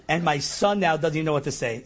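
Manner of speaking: ordinary delivery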